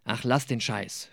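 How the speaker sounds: ordinary delivery, nothing marked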